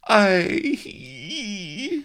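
high, loud drawn-out wail. A narrator's reading. Princess wailing to her maid, high loud wail. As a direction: let the word break into a wail. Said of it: "wailed the princess".

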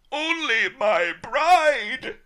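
high, slow anguished howl. A narrator's reading. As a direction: wail it out in grief. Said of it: "I howled".

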